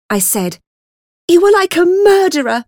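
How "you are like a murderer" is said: high, loud, angry accusation. A narrator's reading. Child accuses her bullying attacker, angry. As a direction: hurl the accusation, raised and high. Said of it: "I felt angry".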